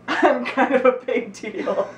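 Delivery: slow, laughing self-brag. Real, not acted. Laughing brag after reading.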